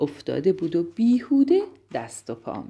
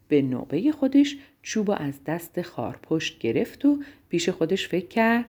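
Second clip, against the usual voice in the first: usual speaking voice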